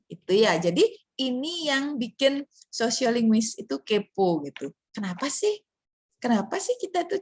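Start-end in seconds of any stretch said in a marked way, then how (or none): none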